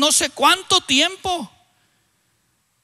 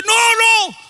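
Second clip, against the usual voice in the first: high, loud warning; alarmed shout; 8 semitones higher, 5 dB louder than usual. Retold or imitated. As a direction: cry out in fright. Jorge recoils from the wound.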